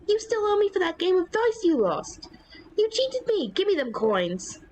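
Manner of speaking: high, indignant guard quarrel; squabbling high guard voice. Acted. Guards quarrelling over coins.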